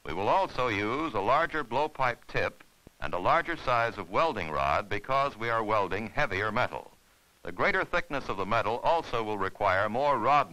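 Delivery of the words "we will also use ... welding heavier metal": usual speaking voice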